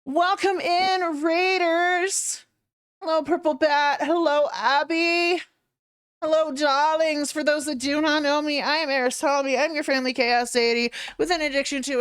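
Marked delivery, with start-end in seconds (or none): none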